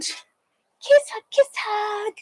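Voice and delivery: high, drawn-out cutesy voice; cutesy kiss hug. Acted. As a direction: squeak it slowly like sending affection. Voicing a message's kiss and hug.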